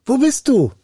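calling out. A narrator's reading. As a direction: call out to a hidden voice. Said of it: "Da rief er".

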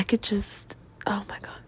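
breathy whisper, trailing off in disbelief; whispered exasperation. Real, not acted. Whispering her exasperation to listeners about the ex. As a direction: whisper it like you can't believe yourself. Exasperated aside mid-story.

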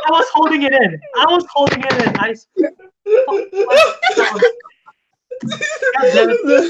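high, helpless laughing protest; protesting through real laughter, pitch climbing. Real, not acted. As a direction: defend yourself while cracking up. Teased about crying on a plane.

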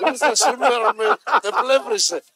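laughing through the words, slowed; giggling as he tells it. Real, not acted. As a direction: laugh while speaking. Laughing while retelling.

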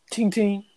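high ringing double ting; two little high-pitched pings; imitated ting. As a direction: mimic the high chime. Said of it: "All you hear is".

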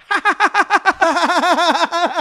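loud, high, rapid-fire cackle; big staccato imitation laugh; Ray Liotta laugh. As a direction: do Ray Liotta's long machine-gun laugh, hammed-up. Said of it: "Ray Liotta, when he like".

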